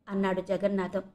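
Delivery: ordinary delivery, nothing marked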